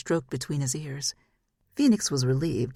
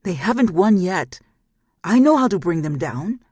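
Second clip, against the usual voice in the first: loud, higher barking command; a loud, higher bark demanding attention; 4 semitones higher, 9 dB louder than usual. Acted. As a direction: bark it to make them listen. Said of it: "barked at them to listen".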